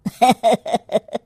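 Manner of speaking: scoffing laugh at the customer; a short derisive chuckle; mocking laugh. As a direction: laugh at his foolishness. Mother-in-law laughs at customer.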